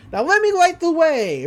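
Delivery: high, loud, triumphant call; cheerful announcement. Retold or imitated. Girl lighting up her sweater in the dark, cheerful.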